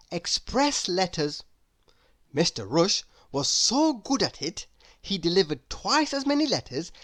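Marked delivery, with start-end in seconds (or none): none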